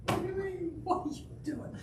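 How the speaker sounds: high, soft falsetto murmur; retold bewildered dad. Dad watching from the ground, mock faint disbelief. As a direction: imitate a stunned parent in a small high voice. Dad's reaction from the ground below.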